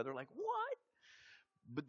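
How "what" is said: very high squeaked what; mock disbelief. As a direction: imitate friends' incredulous reaction. Friends' shocked reaction, retold.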